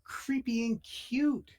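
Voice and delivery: high, slow, squealing delight; high giddy squeal over a creepy toy. Acted.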